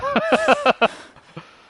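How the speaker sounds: big surprised laugh; loud burst of laughter. Real, not acted. Finds two skeletons, amused.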